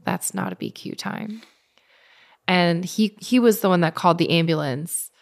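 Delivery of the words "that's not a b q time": much lower and softer, crushed; heartbroken. Retold or imitated. Runner seeing her qualifying time slip, crushed and soft. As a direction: let it sink in quietly, defeated. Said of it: "it broke my heart".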